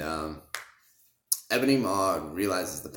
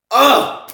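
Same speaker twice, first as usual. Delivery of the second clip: ecstatic cry; 17 dB louder than usual. Real, not acted. Gushing over the suit.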